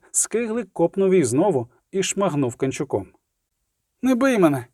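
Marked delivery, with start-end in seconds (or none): 4.0-4.7 high-pitched cry for mercy; cried-out plea. A narrator's reading. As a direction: cry out as if cowering from blows. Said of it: "крикнула вона, скорчившись".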